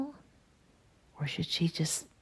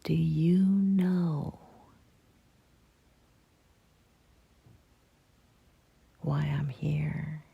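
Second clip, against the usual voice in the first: slow, lightly voiced owl figure; mysterious owl; 4 dB louder, slower than usual. A narrator's reading. Owl questioning the girl in the woods, slow and mysterious. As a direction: ask slowly, with a hint of solemn mystery.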